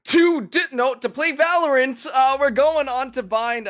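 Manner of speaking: high, loud esports caster hype; hyped caster. Real, not acted. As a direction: amp up the crowd for the match. Caster hyping game start.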